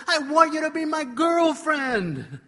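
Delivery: yelled out loud and high, with a laugh; desperate kid yelling his plea, laughing. Retold or imitated. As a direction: blurt it at the top of your lungs. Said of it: "I threw my hands up and I yelled".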